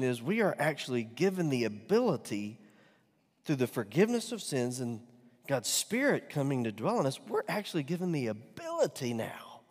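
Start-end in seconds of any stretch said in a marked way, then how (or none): none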